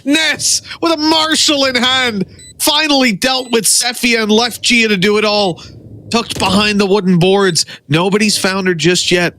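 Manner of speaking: high excited play-by-play; hyped esports caster. Real, not acted. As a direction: ride the tension of the clutch. Clutch situation builds.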